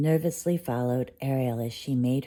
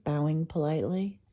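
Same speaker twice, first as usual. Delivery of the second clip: ordinary delivery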